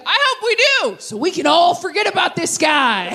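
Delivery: high, shouted gleeful dig; gleefully twist the knife; snide dad. Retold or imitated. Dad jabbing at mom's ex, high gleeful shout. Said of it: "He's like".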